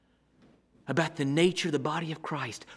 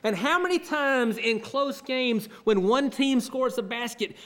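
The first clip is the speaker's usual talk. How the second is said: usual speaking voice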